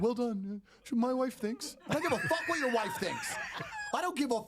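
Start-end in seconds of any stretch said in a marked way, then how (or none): 0.0-1.8 pitched-up mocking voice of a smug husband. Mocking a stranger who praised him, pitched up. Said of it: "and he's like". 1.9-3.3 high, heated comeback; heated retort. Retold or imitated. Snapping back at the stranger, pitched high.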